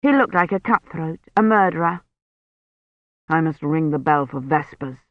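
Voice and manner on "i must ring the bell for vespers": lightly voiced priest, pitched lower; a hint of a low, stubborn priest; insistent priest. A narrator's reading. Priest to Kivrin about vespers, lightly voiced low insistence.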